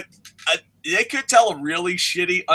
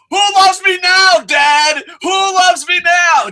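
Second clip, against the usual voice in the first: higher, louder wailing shout at his father; tearful yelling breakdown; 13 semitones higher, 6 dB louder, slower than usual. Retold or imitated. Vince yelling at his dead father on stage, crying shout. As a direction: break down and scream it at Dad. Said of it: "I can just see him break it down".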